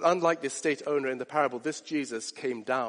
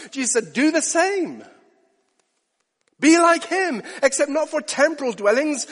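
loud, high-pitched sermon climax; preaching at full force; 7 semitones higher, 9 dB louder than usual. Acted. Preacher urging the congregation to plan for eternity, preaching at full force. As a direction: pour it out from the pulpit.

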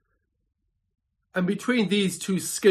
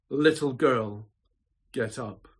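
tender; 4 semitones lower than usual. Acted. Jesus raising the dead child.

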